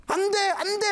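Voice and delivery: high panicked "no, no"; frightened cry. Retold or imitated. Man waking from a nightmare, crying out in fear. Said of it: "하면서 잠에서 딱 깬 거예요".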